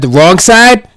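emphatic. Acted. Punchline of options list.

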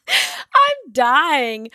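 cracking up, high and drawn out; helpless laughter through the words; laughing. Real, not acted. Laughing at own accent slip.

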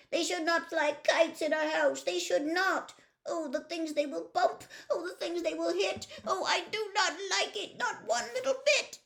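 high, loud, fussy fish; upset fish voice. Acted. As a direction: play a panicky little fish, loud and high. Fish whining.

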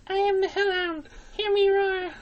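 very high, small squeaky voice; alternative Hellhound take. Acted. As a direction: squeak it out, far above your own voice. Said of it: "You could also go".

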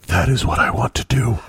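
put-on possessed child. Imitates daughter's intense, possessed reaction.

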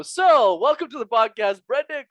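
high, loud hammed-up host intro; mock podcast host. Host introducing a guest whose name they botch, hammed-up. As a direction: boom it out high like an overeager host.

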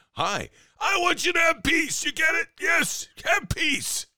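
high falsetto mock shout; mocking politician. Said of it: "From politicians yelling for peace".

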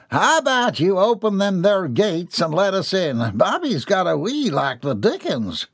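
rustic visitor hollering to be let in; hillbilly father, cheerful. A narrator's reading.

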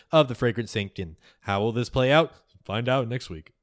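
loud, lifted podcast sign-off voice; big, projected shout-out read; show announcer. Acted. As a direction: announce it like a cliffhanger teaser. Reads patron shout-outs as announcer.

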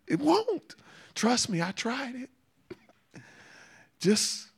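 a high, slow joking aside; playful high tease. Real, not acted. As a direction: tease the congregation kindly. Preacher teasing congregation.

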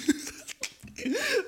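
high wheezing laugh; high giggle. Real, not acted. Laughing about the dude, high giggle. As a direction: let the giggle out.

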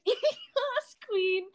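lightly high "yes queen"; a hint of a sassy woman; man doing a woman's voice. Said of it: "Then you can use your woman voice".

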